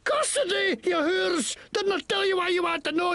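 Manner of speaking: high, furious yell at the lawmen; angry outburst. Acted. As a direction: spit the insult out in rage. Arrested, yelling at the lawmen.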